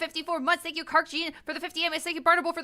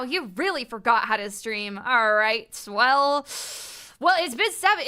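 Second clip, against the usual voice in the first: usual speaking voice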